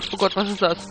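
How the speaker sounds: ordinary talk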